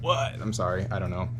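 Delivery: normal talking voice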